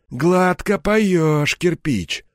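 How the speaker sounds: slow, drawn-out high remark; wry, uncondemning scoff. A narrator's reading. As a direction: scoff lightly, stretching the words. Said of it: "хмыкнул главарь, но уже без осуждения". (протяжно хмыкнуть, без осуждения)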